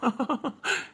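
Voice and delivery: very quiet amused chuckle; light teasing laugh; soft laugh. Acted. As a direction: laugh at her worry. Husband laughs again.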